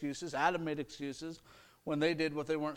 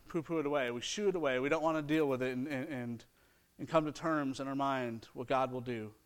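usual speaking voice

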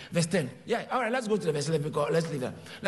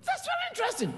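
preaching; 9 semitones higher than usual. Acted. Preaching at full force.

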